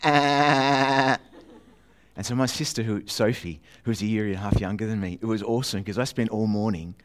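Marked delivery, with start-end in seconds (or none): 0.0-1.2 high loud buzzing "Ah" like a toy ray gun firing; ray gun noise. Retold or imitated. Boy showing off his ray gun with a loud zap. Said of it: "it went like this".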